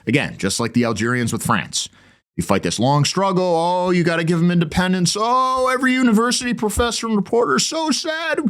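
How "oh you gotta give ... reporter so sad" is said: high, sing-song mock pity for the colonies; mocking whiny sympathizer. Host mocks professors and reporters backing independence, whiny high mock pity. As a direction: ham up a simpering professor lamenting.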